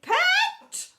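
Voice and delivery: shrieked, very high summons; shouting. A narrator's reading. Headmistress summons teacher from upstairs window, shouting. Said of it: "poked her head out and shouted".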